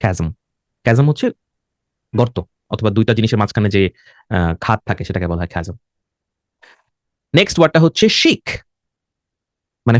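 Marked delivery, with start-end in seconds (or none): 0.0-0.3 "chasm" as KAZ-um, hard k. Shown as a demonstration. Teacher models the k sound, "chasm" as kaz-um. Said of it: "সিএইচ এর উচ্চারণ এখানে কয়ের মতো". 8.2-8.4 "chic" said SHEEK. Shown as a demonstration. Teacher models the next word, "chic" as sheek. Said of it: "সিএইচ এর উচ্চারণ হচ্ছে শ এর মতো".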